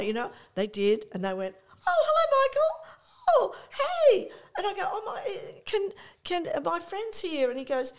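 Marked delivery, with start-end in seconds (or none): 1.9-4.3 very high, loud put-on greeting; hammed-up squeal of hello, as if by chance; feigned surprise. Friends pretending to wander by the table, mock-surprised. 4.8-7.5 high, hesitant put-on voice; feigned surprise. Teller asking Lou if friends can join, mock-surprised.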